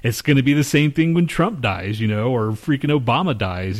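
ordinary talk, nothing marked

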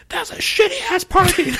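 high, slow jeering voice with a laugh; mocking heckler. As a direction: jeer it from across the lot. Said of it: "I hear this guy go".

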